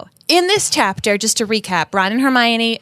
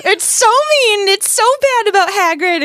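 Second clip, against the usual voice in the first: higher-pitched mock upset; mock distress; 9 semitones higher than usual. Recapping the nasty article about Hagrid, mock distress.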